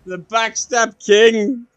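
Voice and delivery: loud, slow, laughing proclamation of the backstep king; laughing mock title. Real, not acted. Mocking opponent while laughing.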